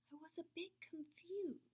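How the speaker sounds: hushed confusion; puzzled whisper. A narrator's reading. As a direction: wonder it quietly. Puzzled by good smell.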